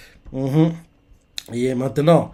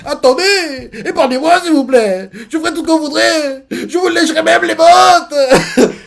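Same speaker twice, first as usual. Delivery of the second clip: much higher, wailing plea for mercy; hammed-up sobbing clown; tearful begging; 11 semitones higher, 3 dB louder than usual. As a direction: beg like a crying coward, voice cracking at the end. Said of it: "en larmes, il fait".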